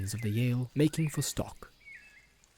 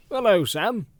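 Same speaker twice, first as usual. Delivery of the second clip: put-on old gaffer voice; 8 semitones higher, 7 dB louder than usual. Gaffer voiced in Sam's tale.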